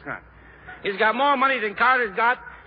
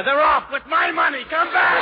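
louder, higher yell they're off, then come back; mock shout after horses; 5 semitones higher, 5 dB louder than usual. Comic yelling after the horses that took his money. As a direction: holler after the runaway horses.

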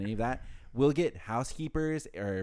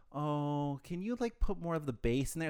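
imitating picky customer; 3 semitones higher, 3 dB quieter than usual. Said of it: "go like".

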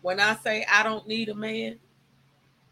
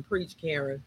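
normal talking voice

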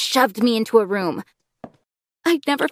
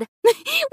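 giggle. Acted. Cousin presents onion idea.

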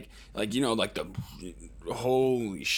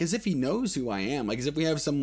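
usual speaking voice

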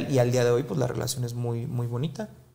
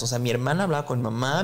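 ordinary delivery

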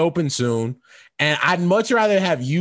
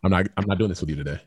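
exasperated warning; 8 semitones lower, 6 dB quieter, faster than usual. Real, not acted. Shutting down Tony's teasing.

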